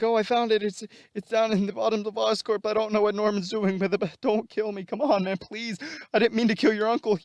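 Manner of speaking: high-pitched, desperate pleading; panicked begging. Retold or imitated. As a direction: beg him not to kill you. Electro begs for his life.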